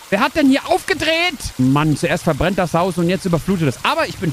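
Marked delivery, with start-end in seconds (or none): 0.1-1.4 much higher pitched shocked question; who did this, in disbelief; shocked outcry. Real, not acted. Shocked by the flooding. (hohe, entsetzte Frage)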